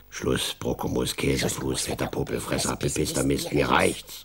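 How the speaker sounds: low, grumbling creature scolding; scolding along. Acted. Oma Undu scolds along with angry Elias, low grumbling. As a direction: rant the silly insults in a low creature voice. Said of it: "Und Oma Undu schimpft mit".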